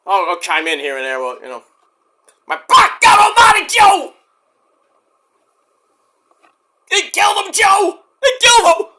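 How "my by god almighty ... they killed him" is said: high, loud crying shouts of disbelief; hammed-up announcer. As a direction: call the carnage to partner Joe, frantic. Commentator impression on imagined show.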